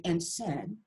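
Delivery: ordinary delivery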